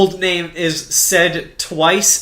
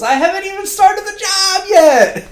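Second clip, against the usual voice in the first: much higher hammed-up protest; mock protest; 5 dB louder than usual. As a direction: squeak it out like a flustered guy. Ryo voiced in retelling.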